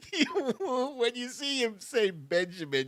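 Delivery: talking through a high laugh; laughing through words. Real, not acted. Host still laughing as he explains the joke, laughing voice. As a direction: still laughing, barely getting it out.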